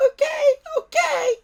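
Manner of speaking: very high squeaky dragon voice, grumbling with a laugh; grumbling little dragon. Acted. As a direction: squeak out a reluctant grumble, loud. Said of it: "grumbled Toothless".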